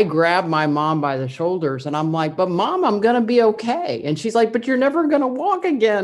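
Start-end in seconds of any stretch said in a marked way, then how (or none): 2.4-4.0 retold insistence, pitched higher and louder; pleading with mom that all is fine, voice raised; reassuring mom. Teen daughter grabbing her crying mom by the shoulders, higher, louder reassurance. 4.5-6.0 higher, louder mock of a distraught mother; retold mother's despair, voiced up high; imitating crying mom. Mother crying in the doctor's office, imitated high and louder.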